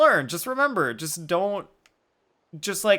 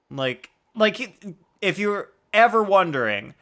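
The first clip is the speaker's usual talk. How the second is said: ordinary talk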